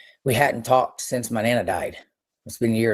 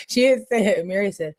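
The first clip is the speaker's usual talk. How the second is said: ordinary talk, nothing marked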